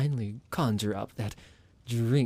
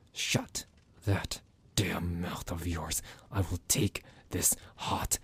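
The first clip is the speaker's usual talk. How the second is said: low threatening whisper, slow and close; menacing whisper; 4 semitones lower, 5 dB quieter than usual. Acted. Vampire whispering a threat to silence the captive, menacing whisper. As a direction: hiss the threat right in his ear.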